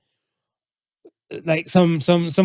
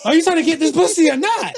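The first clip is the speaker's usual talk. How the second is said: higher, impatient mock demand; retold blunt challenge; 8 semitones higher than usual. Woman confronting the man who turns her down, mock-impatient challenge. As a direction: push it at him, amused.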